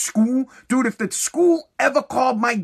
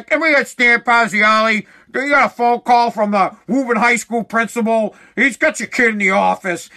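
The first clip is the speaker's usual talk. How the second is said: loudspeaker announcement; 2 semitones higher, 4 dB louder than usual. Retold or imitated. Warehouse manager paging the dad over the loudspeaker, mock PA voice. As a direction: boom it out like a warehouse intercom. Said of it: "he goes over the loudspeaker".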